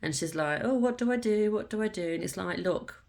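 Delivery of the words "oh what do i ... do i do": higher, mock-sulky "what do I do"; sulky whine. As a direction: whine it like a sulking girl on the card. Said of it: "having a bit of a sulk".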